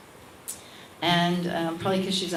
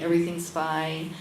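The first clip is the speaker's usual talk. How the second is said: ordinary talk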